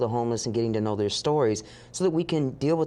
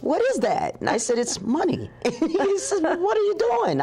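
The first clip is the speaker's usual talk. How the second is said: high, giggly retelling of the questions; laughing retold exchange. Retelling the car exchange with Kevin, laughing and high. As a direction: let laughter push the pitch up.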